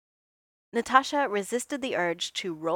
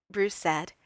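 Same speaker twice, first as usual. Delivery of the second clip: usual speaking voice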